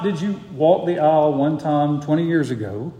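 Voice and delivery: ordinary talk, nothing marked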